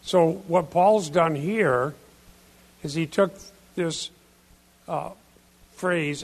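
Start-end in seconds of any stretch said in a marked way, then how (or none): none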